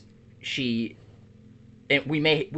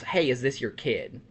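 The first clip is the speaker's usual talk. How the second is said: normal talking voice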